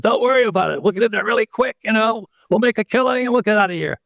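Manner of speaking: high, cocky reenacted boast. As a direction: brag like a young pilot. Co-pilot and I boast.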